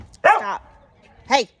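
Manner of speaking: sharp loud shout at the dog; yelling stop. Real, not acted. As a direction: bark it out to make the dog quit.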